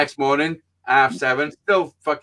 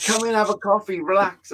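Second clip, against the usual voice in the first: high-pitched coaxing invitation; imitated placating host; 6 semitones higher than usual. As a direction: mimic someone trying to calm a visitor. Voices the couple calming him down.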